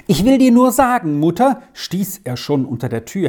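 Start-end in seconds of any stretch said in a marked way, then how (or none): 0.1-1.6 rushed and high, breathless from running in; excited, out of breath. Acted. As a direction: burst in breathless with news. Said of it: "denn er war ganz außer Atem".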